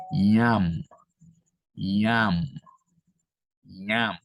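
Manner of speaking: "ñam" drawn out slowly and firmly; slow pronunciation demo. Shown as a demonstration. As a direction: model "ñam" so students can repeat it. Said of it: "Por ejemplo". ("ñam" pronunciado despacio para la clase)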